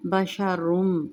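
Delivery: बशरुम with the final m merged and nasal held; slow बशरुम, nasal merge; idgham with ghunna. Shown as a demonstration. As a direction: draw out बशरुम slowly into the m. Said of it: "तनवीन को मीम मुशद्दद में इदगाम".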